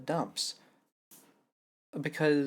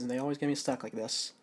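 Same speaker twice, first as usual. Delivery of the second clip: ordinary talk, nothing marked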